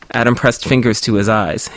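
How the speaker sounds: ordinary delivery, nothing marked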